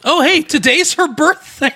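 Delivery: high-pitched burst of real excitement; very excited. Real, not acted. Host wrongly spots the celebrity's birthday, very excited. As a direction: pipe up high, thrilled at a sudden discovery. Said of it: "You were very excited for being so wrong".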